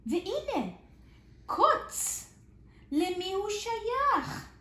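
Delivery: lively storybook reading with big swings; reading to children. Acted. Teacher reads a picture book to small children, lively and expressive. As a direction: read it out like a kindergarten story.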